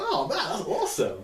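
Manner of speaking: high-pitched acted-out excitement; a lift of fannish delight; retold delight. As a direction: mimic your own thrilled reaction. Acts out delighted reaction to cameo.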